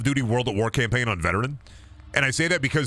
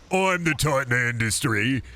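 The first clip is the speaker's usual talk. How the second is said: slow, higher, swelling boast; titan of industry; 4 semitones higher, much slower than usual. Acted. Streamer posing as titan of industry to chat, slow grand boast. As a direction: puff yourself up as a bloated tycoon. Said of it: "I'm going to show you the titan of industry".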